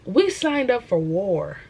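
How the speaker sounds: loud, high, rousing preacher voice; fired-up preaching. Acted. Young believer preaching to viewers, loud and fired up. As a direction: preach it like a revival sermon.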